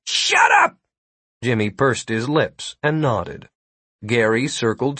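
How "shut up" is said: very high, loud yell. Acted. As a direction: snap it out as a shout. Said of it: "Gary yelled".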